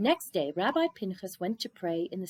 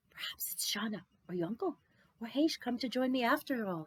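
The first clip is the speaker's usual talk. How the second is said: whispered thought; 4 semitones higher than usual. A narrator's reading. Rabbi's private hopeful thought.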